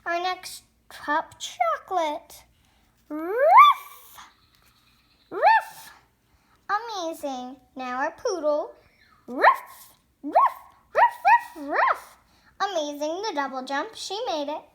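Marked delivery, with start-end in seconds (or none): 3.1-5.9 high, loud yappy puppy bark. Pretend. Toy puppy barking at its turn, high and loud. Voicing the dog. 9.2-12.2 high, loud yappy puppy bark. Pretend. Toy puppy barking at its turn, high and loud. Voicing the poodle.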